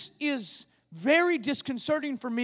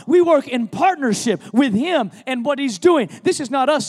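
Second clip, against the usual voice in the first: louder, building preacher's exhortation; rally the church to get up and work; preaching; 2 semitones higher, 5 dB louder than usual. Acted. Pastor urging the congregation to work with God, preaching louder. Sermon building to full force.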